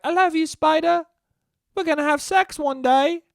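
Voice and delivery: put-on very high tender kid voice; little boy to a spider. James talks to his spider, high little boy voice. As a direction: coo it like a tiny lovestruck boy. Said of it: "He's like".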